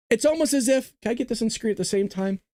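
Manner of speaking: ordinary talk, nothing marked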